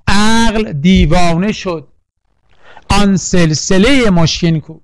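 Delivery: slow, measured line for students; slow recital. Shown as a demonstration. Teacher reciting verse slowly for class to follow. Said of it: "یک رو با من بخونا".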